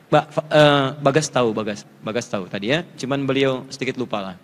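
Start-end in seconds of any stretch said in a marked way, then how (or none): none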